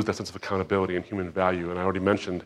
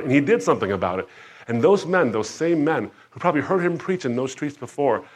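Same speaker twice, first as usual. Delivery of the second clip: loud, higher, rising sermon; fiery preaching; 3 semitones higher, 5 dB louder than usual. Acted. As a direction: preach it out with fire. Preaching at full force.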